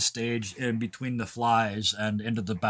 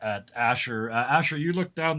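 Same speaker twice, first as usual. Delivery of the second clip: ordinary delivery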